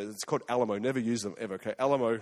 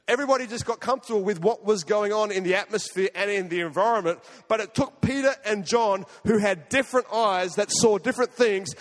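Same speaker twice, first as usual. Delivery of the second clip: preaching; 6 semitones higher than usual. Acted. A pastor preaching the story of Peter and John, rising, full-voiced sermon delivery. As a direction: drive the point home to the congregation.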